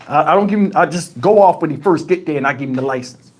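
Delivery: plain reading voice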